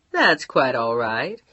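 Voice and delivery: a calm Californian operator, in no rush; unhurried operator. Acted. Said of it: "sounded Californian and unhurried".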